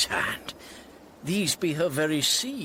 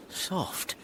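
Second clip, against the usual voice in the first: hushed, secretive breath; secret whisper; 9 semitones lower, 4 dB quieter than usual. Acted. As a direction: whisper it over the seal. Breaking the seal secretly.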